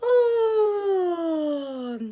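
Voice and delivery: long drawn-out yawn; big yawn. Pretend. Acted yawn on request.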